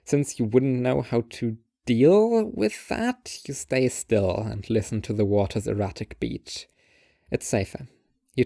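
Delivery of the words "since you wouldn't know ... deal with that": ordinary talk, nothing marked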